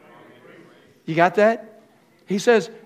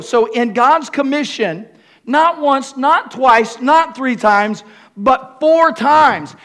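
each count louder and slower; building emphasis; 4 semitones higher, 6 dB louder, slower than usual. Real, not acted. Preacher stressing the four repetitions to the church. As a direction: climb with every count.

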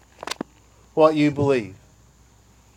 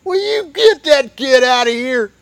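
put-on high, louder snippy complaint about a crying baby; annoyed churchgoer; 8 semitones higher, 3 dB louder than usual. Churchgoer fed up with a wailing baby, snippy high complaint. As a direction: hiss it like an irritated pew-sitter. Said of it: "and you're going".

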